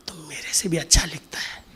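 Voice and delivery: tender whispered praise; loving whisper. Retold or imitated. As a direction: whisper it fondly, like a grandmother. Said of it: "बड़े प्यार से". (दादी का प्यार भरा फुसफुसाता स्वर)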